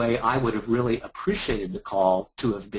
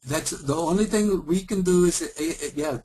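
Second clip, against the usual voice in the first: higher put-on Eastern European accent; mock agent insisting on the all-day wait in an Eastern European accent; hammed-up Eastern European accent; bad Eastern European accent; 5 semitones higher than usual. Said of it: "really bad Indian and Eastern European accent".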